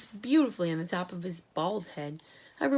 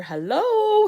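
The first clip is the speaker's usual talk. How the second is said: much higher, louder yelled hello; 13 semitones higher, 11 dB louder than usual. A narrator's reading. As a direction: bellow it down the line. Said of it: "he yelled".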